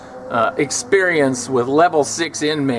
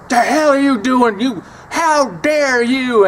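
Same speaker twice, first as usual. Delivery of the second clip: higher, louder outraged yell, slowing at the end; 10 semitones higher, 5 dB louder, slower than usual. Retold or imitated. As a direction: bark it at him, higher and louder. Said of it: "And he went crazy".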